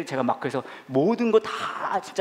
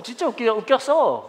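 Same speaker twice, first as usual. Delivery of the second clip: put-on thrilled kid; 9 semitones higher, 3 dB louder, slower than usual. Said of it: "그랬대요".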